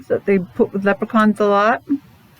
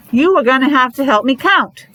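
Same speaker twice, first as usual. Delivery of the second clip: normal talking voice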